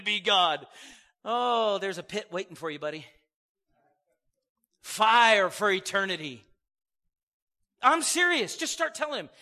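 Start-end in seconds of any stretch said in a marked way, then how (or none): none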